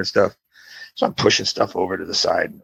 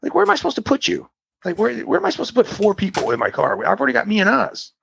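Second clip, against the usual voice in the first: high-pitched, incredulous protest; worked-up, high-voiced objection; exasperated; 14 semitones higher, faster than usual. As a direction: argue back in exasperated disbelief. Protesting four passengers in car.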